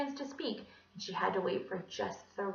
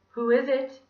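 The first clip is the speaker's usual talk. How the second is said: lightly voiced Scottish gentleman, raised pitch and level; 5 semitones higher, 8 dB louder than usual. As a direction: call to the door as a polite Scot. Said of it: "he asked in his Scottish voice".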